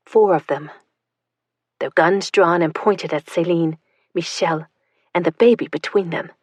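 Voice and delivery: usual speaking voice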